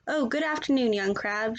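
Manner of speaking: usual speaking voice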